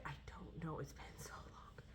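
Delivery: soft unvoiced whisper to herself; whispered aside. Real, not acted. Vlogger muttering to herself about her old book, whispered. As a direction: let it drift off under your breath.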